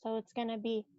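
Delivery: usual speaking voice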